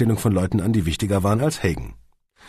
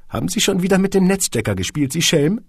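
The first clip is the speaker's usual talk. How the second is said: higher, a touch tart; sour Elvira; 11 semitones higher, 5 dB louder than usual. A narrator's reading. Secretary chides the boss, a touch sour. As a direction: scold him sourly. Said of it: "fragte Elvira säuerlich".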